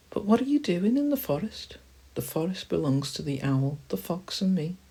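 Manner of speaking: very high little squirrel voice; curious squirrel. Acted. Squirrel questioning visitors to the forest, high-voiced. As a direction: question them in a high animal voice.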